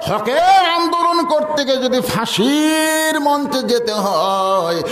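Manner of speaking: high, drawn-out sermon chant; impassioned preaching. Real, not acted. Preacher rallying Muslims for the path of truth, impassioned chant. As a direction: stretch the line endings like a rallying chant.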